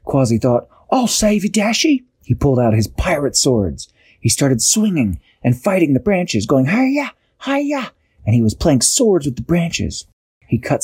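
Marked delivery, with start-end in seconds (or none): 0.9-2.0 high-pitched heroic promise; heroic call. A narrator's reading. Quasi calls to trapped Dashi, heroic call. 6.7-7.9 very high-pitched fighting yelp; play-fight "hi-ya", pitched way up; battle cry. A narrator's reading. Storyteller voices Quasi sword-fighting branches, high battle cry.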